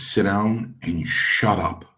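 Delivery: slow, measured retold command; retold firm order. Strongbow dismissing the new wrestler, slow and firm. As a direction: spell it out slowly for the newcomer.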